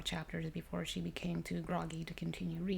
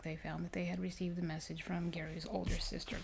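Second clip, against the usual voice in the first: plain reading voice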